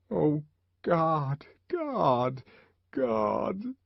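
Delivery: the savage grieving by the bed, anguished; grieving repetition. A narrator's reading. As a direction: grief spilling out. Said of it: "kept repeating to himself".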